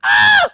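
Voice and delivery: very high, loud shriek; terrified disciples, shrill shriek; high girlish scream. Retold or imitated. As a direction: scream like a little girl. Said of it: "screaming like little third grade girls".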